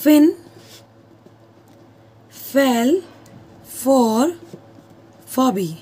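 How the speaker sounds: Finn, fell, for, Fabi each said alone with long pauses; slow, word by word. Shown as a demonstration. Teacher reading an alliteration example slowly. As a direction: space out every word slowly. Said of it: "एक example और दे रही हूँ".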